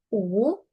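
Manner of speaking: U dipping, then rising; third tone. Shown as a demonstration. Teacher models the double U, U dipping then rising. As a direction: let U sink and swing upward. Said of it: "tercer tono".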